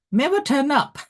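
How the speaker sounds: squeaky high punchline; high squeak. Real, not acted. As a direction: squeak it out in amused disbelief. Punchline about no-shows.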